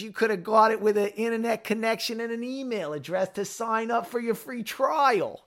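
put-on hammy Boston accent, pitched high; bad Boston accent. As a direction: keep the mock Good Will Hunting Boston accent. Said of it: "That's such a bad Boston accent".

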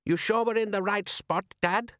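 high-pitched son questioning his father; puzzled son in a high voice; young son. Acted.

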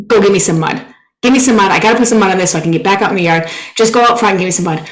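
loud, urgent demand for mud; screaming. Retold or imitated. Stung by bees, yelling at her friend for mud. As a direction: bark it in a stung panic. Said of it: "I'm screaming so much".

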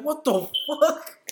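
high, drawn-out incredulous question; baffled disbelief. Retold or imitated. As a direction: stretch it out in stunned disbelief. Retelling baffled reaction to refusal.

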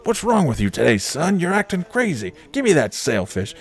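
high, fast, exasperated boss rant; Cookie voice. Retold or imitated. Boss berating Lenny about the bear, high fast rant. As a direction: rattle it off like a fed-up boss.

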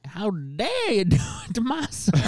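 high, slow, hammed-up indignant mother; exaggerated mom impression. Trying what if your mom said it like this, mock indignant. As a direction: pitch it up and drag it out in a silly put-on voice. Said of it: "what if your mom said it like".

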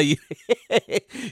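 high, stuttering giggle; quick high chuckles; real laugh. Real, not acted. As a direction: laugh helplessly at your own story. Laughs at own burned-kid story.

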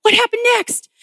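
put-on a curious child leaning forward; eager question. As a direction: beg to hear the rest. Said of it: "And I was like".

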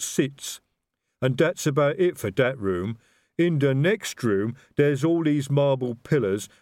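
slow, expansive troll explaining; lumbering troll holding forth. A narrator's reading. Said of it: "Detritus's voice in full, expansive explanation".

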